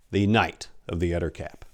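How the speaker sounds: ordinary delivery, nothing marked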